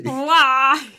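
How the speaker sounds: loud echoing why; anguished why. Retold or imitated. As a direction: wail it back. Echo of why.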